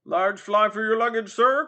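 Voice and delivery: high, loud coachman's shout; crying out. Acted. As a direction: bellow the offer like a street tout. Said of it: "cried a third".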